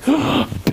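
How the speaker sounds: sharp high mock gasp; pleading gasp. Coach imitating a girl left behind, pleading gasp. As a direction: gasp like someone about to beg. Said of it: "begging, you know".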